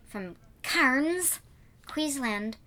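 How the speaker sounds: botched Aussie accent attempt; bad Australian accent. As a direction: try an Australian accent and miss. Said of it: "That's the wrong accent".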